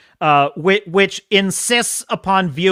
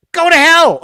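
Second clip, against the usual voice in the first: louder, higher angry outburst; go to hell; 8 semitones higher, 8 dB louder than usual. Real, not acted. Host snapping at the condescending commentators, angrily. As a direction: spit it out in disgust. Ranting at the pandering.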